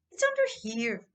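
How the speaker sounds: sulky. Acted. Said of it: "he said sulkily".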